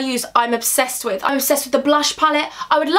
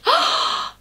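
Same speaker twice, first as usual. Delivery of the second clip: sharp gasp; 14 semitones higher than usual. Real, not acted. Reacting to finished look.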